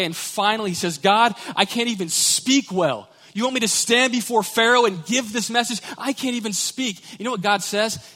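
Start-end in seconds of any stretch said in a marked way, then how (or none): 1.0-7.0 pleading Moses. Acted.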